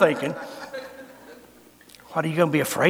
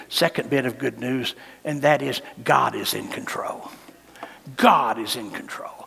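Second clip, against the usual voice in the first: whispered, lower and softer; hushed whisper; 5 semitones lower, 6 dB quieter than usual. Acted. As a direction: lean in and whisper God is in control. Whispered reassurance.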